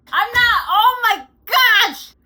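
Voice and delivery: very high, loud shocked shriek; shocked scream. Real, not acted. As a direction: shriek in surprise. Shocked while eating candy.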